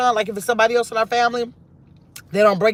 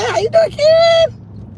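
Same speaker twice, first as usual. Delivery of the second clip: much higher, slow, drawn-out hello; sing-song greeting; 5 dB louder, slower than usual. Real, not acted. Greeting viewer Karen as she joins, high and sing-song.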